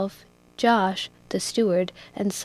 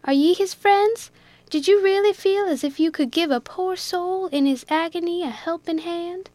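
much higher, earnest plea; pleading Jack; 8 semitones higher than usual. A narrator's reading. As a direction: beg them to care for the stranded man.

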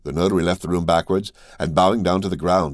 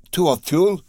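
startled question leaping much higher; alarmed cry; 12 semitones higher, 3 dB louder, slower than usual. A narrator's reading. Steward hears the Count's plan, an alarmed cry. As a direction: blurt it out in dismay. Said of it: "cried Bertuccio".

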